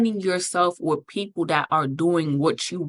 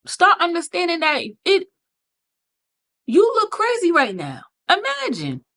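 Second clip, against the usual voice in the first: incredulous mocking; 7 semitones higher than usual. Real, not acted. Mocking disbelief rant.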